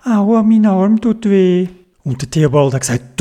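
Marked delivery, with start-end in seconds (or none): 0.0-1.7 slow, moaning complaint; hurting grandma. A narrator's reading. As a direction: groan through the pain. Grandma hurt after the fall. (langsames Jammern vor Schmerz)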